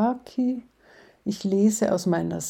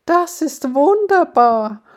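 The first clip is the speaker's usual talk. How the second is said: higher and louder, awed; admiring gasp; 8 semitones higher, 8 dB louder than usual. Acted. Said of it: "keucht Molly bewundernd".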